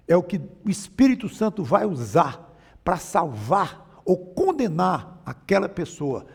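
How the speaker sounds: normal talking voice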